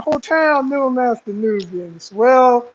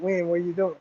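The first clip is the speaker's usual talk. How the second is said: low, soft undertone; hushed warning; 4 semitones lower, 10 dB quieter, faster than usual. Retold or imitated. Recruit to the friend in front of him in line, hushed warning. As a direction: warn him without being overheard.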